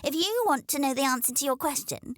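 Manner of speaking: high, brisk snooty hen. A narrator's reading. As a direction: dismiss the crow. Hen dismisses crow.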